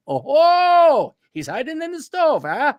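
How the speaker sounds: high, loud triumphant 'oh ho'; gotcha cop. Pretend. Cop catching the liar about the stove, triumphant high gotcha. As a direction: pounce like a cop who caught him.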